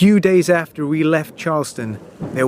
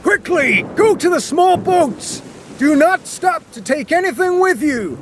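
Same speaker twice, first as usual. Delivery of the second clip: high, frantic shouted orders; captain hurries passengers to the small boats, shouting; 13 semitones higher than usual. Acted. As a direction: bark the commands over the wind. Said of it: "the captain shouted to everyone".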